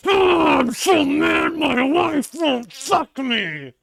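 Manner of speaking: high, loud hammed-up shouting; mock conservative rant. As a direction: yell it like a raging caricature conservative. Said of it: "That's your average conservative".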